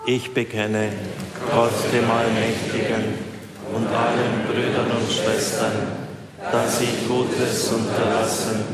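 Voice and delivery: slow, measured communal prayer; prayer recitation. Real, not acted. Congregation confessing sins before God, slow solemn recitation. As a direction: recite slowly in unison, evenly paced.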